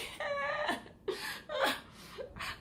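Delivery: a high wail between laughing and crying; high laughing sob. Retold or imitated. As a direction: let out a helpless laugh-cry. Meltdown in airport.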